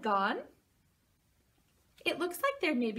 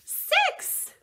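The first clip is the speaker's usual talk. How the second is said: bright, much higher cheer; excited; 8 dB louder than usual. Acted. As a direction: celebrate the right answer. Child guesses the rhyme.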